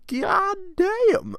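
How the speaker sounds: very high, loud shriek; mock horrified yell. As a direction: screech it in exaggerated shock. Mock horror at the story.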